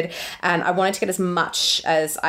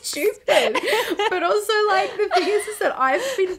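laughing; 12 semitones higher than usual. Real, not acted. Giggling over own silly story.

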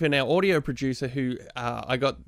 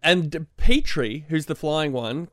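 ordinary talk, nothing marked

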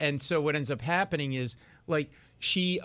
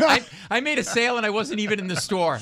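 loud, high-pitched comic disbelief; incredulous outburst; 6 semitones higher, 7 dB louder than usual. Retold or imitated. Comic telling of an accidental sale, incredulous outburst. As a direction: blurt the punchline louder and higher. Incredulous punchline of his story.

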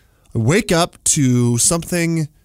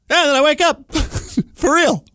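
loud, high punchline; excited; 13 semitones higher, 3 dB louder than usual. Real, not acted. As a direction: blurt it out laughing at yourself. Punchline of the dream story.

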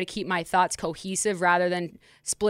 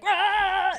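wind whoosh imitation; 12 semitones higher than usual.

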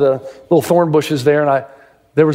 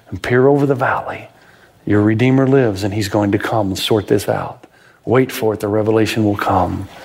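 lower, hushed reassurance to the troubled; God reassuring; 6 semitones lower than usual. Acted. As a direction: speak gently as a loving father. God voiced reassuring listeners.

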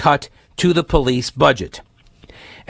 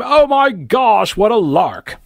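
mock delight; 6 semitones higher, slower than usual.

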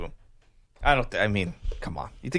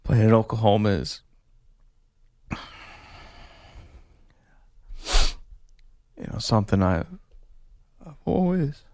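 mock tearful athlete, halting with long pauses; half-hearted choked-up interview voice, breaking off mid-thought; fake choked-up crying; 3 dB quieter than usual. Host tries faking a tearful player interview, choked up. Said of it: "let's see if I can".

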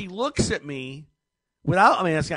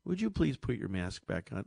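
polite request; 6 semitones lower, 9 dB quieter than usual. Retold or imitated. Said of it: "maybe if you would have tried".

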